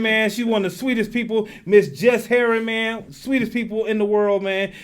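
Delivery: ordinary delivery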